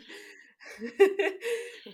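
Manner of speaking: bursting out laughing, high and loud; big laugh. Real, not acted. Laughing at her own [name] nose joke, loud laugh.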